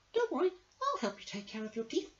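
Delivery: high, squeaky mouse voice; tiny helpful mouse. Acted. Mouse explaining the toothache to the crocodile, squeaky. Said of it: "answered the mouse".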